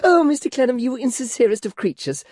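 put-on high, girlish, fluttering chatter; coquettish Flora. Flora fishing for compliments from Clennam, high and girlish. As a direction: play the flustered former belle.

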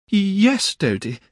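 shy Dora. A narrator's reading. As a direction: falter then brighten. Dora answers her husband.

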